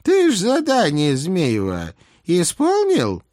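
put-on high, slow old hag question; old witch voice. A narrator's reading. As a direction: probe like a sly old witch. Baba Yaga voiced by reader.

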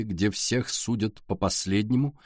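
plain reading voice

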